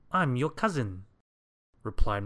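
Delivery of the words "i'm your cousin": high, loud, jolly visitor; breezy long-lost relative; cheerful cousin. A narrator's reading. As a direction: announce yourself brightly and obliviously. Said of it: "continued Mister Clumsy cheerfully".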